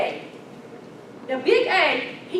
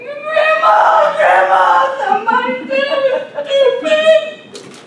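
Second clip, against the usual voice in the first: put-on high, loud, wailing child's call; little girl crying out; 13 semitones higher, 11 dB louder than usual. Little girl runs to grandma after being called stupid, high loud wail. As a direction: shout for grandma like a hurt little kid.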